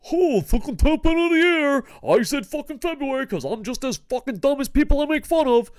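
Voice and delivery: high, loud furious rant; real yelling at his own pick. Real, not acted. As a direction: rage at yourself, full voice. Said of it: "I'm, like, yelling about pro wrestling".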